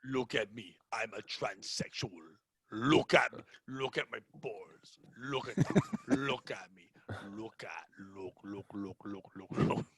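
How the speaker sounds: put-on very low, hushed Jamaican accent. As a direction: parody a Jamaican voice, soft and deep. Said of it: "like, a Jamaican voice".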